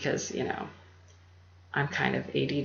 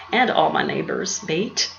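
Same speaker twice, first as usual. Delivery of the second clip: a quick, louder attempt at an Australian accent; 3 semitones higher, 7 dB louder than usual. Greeting her local neighbors in a jokey Australian accent. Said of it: "that was an amazing Australian accent".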